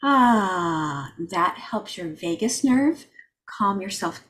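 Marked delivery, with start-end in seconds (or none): 0.0-1.1 sighing "ah" on the out-breath; long exhaled "ah". Shown as a demonstration. Showing the group a calming sound, drawn-out sighed "ah". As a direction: let out a long, calming, falling sigh. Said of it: "do a sound like".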